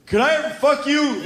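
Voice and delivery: hammed-up awkward young man blurting; blurted out. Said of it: "I would just go".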